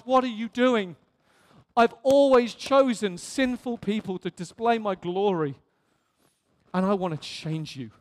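ordinary talk

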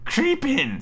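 very high, coaxing witch voice. Acted. Witch urging Gretel into the oven, high coaxing voice. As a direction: lure her in with a high little call. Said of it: "said the witch".

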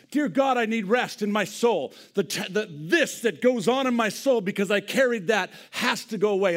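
crying out to God, full voice; impassioned loud prayer. Real, not acted. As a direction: pray it out loud and earnest. Loud heartfelt prayer cry.

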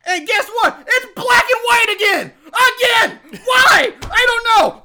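loud, high-pitched comic rant; exasperated shouting. Real, not acted. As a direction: shout it high in baffled outrage. Exasperated rant about the film.

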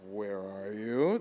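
slow, low, ominous question; imitated stern phone answer. Guest mimicking host's phone manner, stern and slow. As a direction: drag it out low, like someone's in trouble. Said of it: "That's what it sounded".

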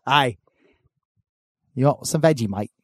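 badly faked Aussie greeting; attempted Australian accent. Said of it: "I can never do an Australian accent".

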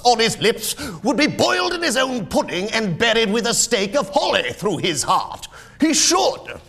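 high, loud tirade of an indignant miser; ranting Scrooge. Acted. Miser rants at his nephew about Christmas, furious. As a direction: build from loud scorn to full high-pitched outrage.

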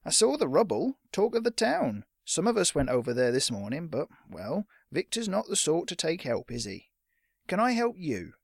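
high, swooping shopkeeper voice; chatty but guarded storekeeper; pointedly polite man behind the counter; wary shopkeeper. Acted. Shopkeeper sizes up the boy.

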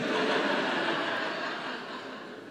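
real laugh. Real, not acted. Jokes he doesn't care.

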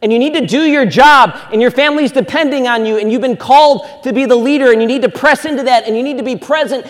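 rally the men like a hyped-up pep talk; rousing men's rally call. Retold or imitated. Pastor firing up men at a rally, loud and high. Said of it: "we'll say things like".

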